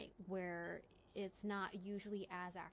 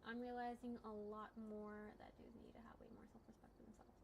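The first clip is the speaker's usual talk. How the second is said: unvoiced, hushed aside; whisper; 9 dB quieter than usual. Real, not acted. Musing to chat about men's self-respect, whispered. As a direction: whisper it like a confession.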